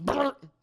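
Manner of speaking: short, loud high buzz; quick mouth-made buzz; imitated buzzer. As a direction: blurt out a buzzer noise. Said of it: "reaches for one and goes".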